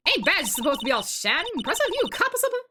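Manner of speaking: skeptical Southern woman; southern accent. Acted. Voices Southern character.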